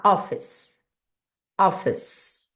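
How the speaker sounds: "office" sem pronunciar o E final; E final mudo. Shown as a demonstration. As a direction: say "office" ending on the S sound, silent E. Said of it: "o E não pronuncia". ("office" com o E final mudo, terminando no som de S)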